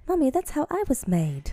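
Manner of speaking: put-on high-pitched little British girl; a little demonish British girl voice; talking stomach. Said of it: "I can't do the British accent".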